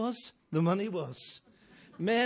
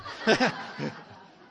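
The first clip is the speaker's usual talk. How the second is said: unvoiced chuckle at the punchline; real laugh after the joke; breathy laugh; 7 dB louder than usual. Real, not acted.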